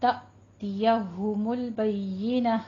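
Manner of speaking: slow "तअतियहुमुल बय्यिना", stopping on a breathed h; recited "तअतियहुमुल बय्यिना" with a pausal ending; waqf ending. Shown as a demonstration. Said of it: "वक्फ कर देंगे इसके ऊपर".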